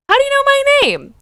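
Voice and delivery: "How do you know my name?" squeaked very high and loud, "my" stressed; baffled, disbelieving shriek; incredulous. Real, not acted. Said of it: "girl, how do you know my name?".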